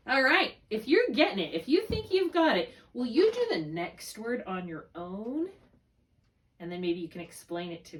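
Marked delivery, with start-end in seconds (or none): none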